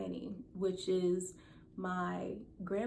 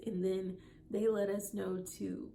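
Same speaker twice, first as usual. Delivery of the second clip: ordinary talk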